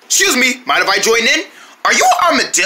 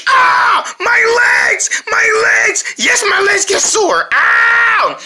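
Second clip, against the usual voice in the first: pained crying out; 11 semitones higher, much slower than usual. Acted. Ad actor with sore legs.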